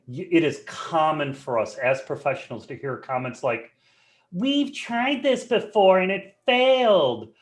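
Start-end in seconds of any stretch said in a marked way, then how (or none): 4.3-7.3 higher, slow, whining imitation of a skeptic; whiny complainer. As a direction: mimic a colleague's whiny voice, dragging it out. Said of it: "that is my imitation of Anne Marie's whiny voice".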